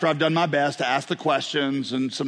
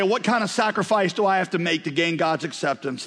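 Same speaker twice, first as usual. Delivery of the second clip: ordinary delivery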